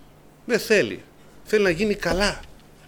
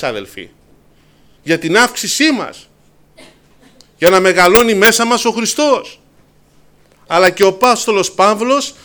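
louder, higher preaching at full force; fervent preaching; 4 semitones higher, 6 dB louder than usual. Acted. Preacher stirring the brethren about Paul's joy, fervent and loud. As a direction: drive it home to the congregation. Sermon builds to fervent climax.

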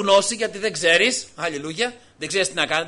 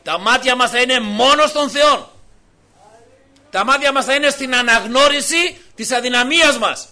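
raised, higher fervent preacher's voice; loud preaching; 5 semitones higher, 8 dB louder than usual. Real, not acted. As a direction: preach it out loud with full force. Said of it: "Συγχωρέστε με για το τόνο της φωνής".

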